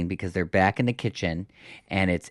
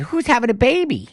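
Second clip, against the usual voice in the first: high-pitched imitation of a stunned daughter; mock shock; 13 semitones higher, 4 dB louder than usual. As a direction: pitch shoots up in acted disbelief. Diana's shocked question, retold.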